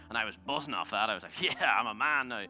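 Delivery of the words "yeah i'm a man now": loud, drawn-out hammed-up boast; mock swagger. Driver buzzing off a champion's praise, mock swagger. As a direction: puff it up slowly, mocking your own pride. Acting out his buzzing pride.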